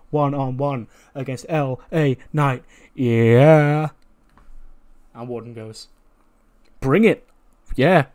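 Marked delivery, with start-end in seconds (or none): 0.1-3.9 slow, drawn-out taunting challenge ending in a loud yeah; LA Knight challenge. Retold or imitated. 6.8-8.1 higher and louder short tough reply; mock tough acceptance. Warden accepts challenge.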